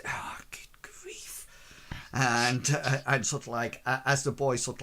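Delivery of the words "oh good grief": exasperated aside. Acted. Master exasperated at the slow boy.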